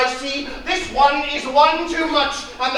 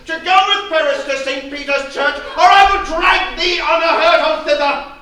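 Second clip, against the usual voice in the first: louder and higher, raging father; father screaming at his defiant daughter; furious shout; 5 semitones higher, 7 dB louder than usual. Acted. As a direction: roar the threat at her.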